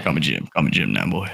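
low, stern dad voice; gruff overprotective dad. Pretend. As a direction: lay down the law like a strict father. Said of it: "let me turn into a dad real quick".

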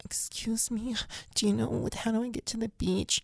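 lifts into a high, half-whispered lost-tourist voice; high breathy ditzy woman. Retold or imitated. Hotel guest mimics lost woman asking for the beach, high ditzy voice. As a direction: play the confused woman asking the way. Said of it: "she's like".

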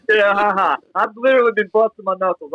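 high, amused laughing talk. Real, not acted. Brushing off his buddy's prayer idea, laughing. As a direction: laugh it off in disbelief. Laughing off friend's odd advice.

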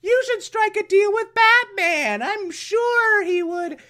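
very high put-on female falsetto; sing-song femme fatale, pitched right up; Octavia. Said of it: "she's like".

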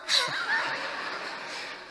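audience laughter. Real, not acted. Kid's question draws laughter.

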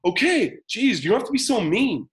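high-pitched imitation of a protesting kid; startled child's retort. Child yelled at by parent, protesting startled. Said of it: "kinda jump, and they're like".

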